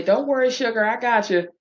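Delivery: cooing flight attendant reassurance; doting attendant. Retold or imitated. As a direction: coo it like a doting host. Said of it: "just to see him say".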